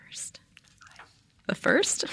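whispered secret about the queen; conspiratorial whisper. Pretend. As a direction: lean in and whisper it.

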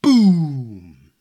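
explosive boom effect; loud boom. A narrator's reading. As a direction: burst it out loud and big. Narrator voicing the explosion.

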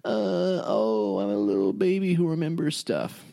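high, babyish mocking voice; mock your co-host like a little baby; whiny baby voice. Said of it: "That's my impression of you".

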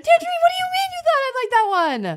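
very high-pitched indignant squawk; outraged protest. Acted. Princess told she'd like a horrible story, outraged. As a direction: protest in squeaky, hammed-up outrage. Acted outraged quarrel with Tangerine.

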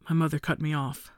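plain reading voice

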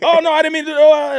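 loud, high-pitched frantic backtracking; hammed-up flustered excuse; mock panic. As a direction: imitate someone horrified by their own mistake. Acting out husband's panicked backtracking.

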